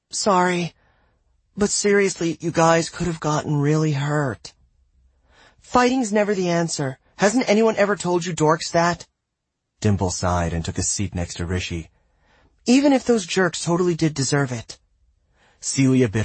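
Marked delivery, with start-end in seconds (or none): 0.1-9.1 put-on high, worried girl scolding; scolding teen girl. Celia scolding her friends. 12.7-14.8 put-on wry teen girl. Dimple's wry aside.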